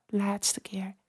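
soft whispered cue; soft spa whisper. Acted. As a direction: breathe the words out softly. Whispered cue in breathing exercise.

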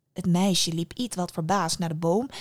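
usual speaking voice

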